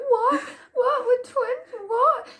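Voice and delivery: high, loud, hammed-up disbelief with a teary laugh; mock shocked squeal. Retold shocked friend.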